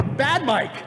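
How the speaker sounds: high-pitched mock scold like to a pet. Panelist playfully scolds Mike for the tangent, high mock reprimand. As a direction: tell him off like a naughty dog.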